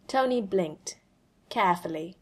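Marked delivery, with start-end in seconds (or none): none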